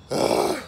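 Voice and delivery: loud, high groan of pain. Real, not acted. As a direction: let out a sharp pained ugh. Heartburn after eating hot peppers.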